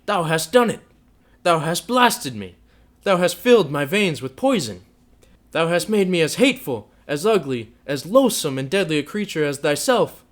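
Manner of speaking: loud, high, furious tirade; wild, high-pitched fury; raging passion. A narrator's reading. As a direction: rail at her without restraint. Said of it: "beside himself with passion".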